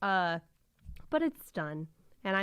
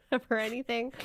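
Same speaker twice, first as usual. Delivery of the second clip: usual speaking voice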